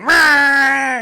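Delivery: high, loud held mock roar; big drawn-out boogeyman roar; monster roar. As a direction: roar long like a cartoon monster. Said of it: "just him going".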